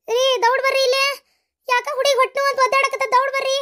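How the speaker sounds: high, urgent calling; panicked summons, pitched high; alarmed. Acted. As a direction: call for help in a panic. Mother calls husband as labour starts.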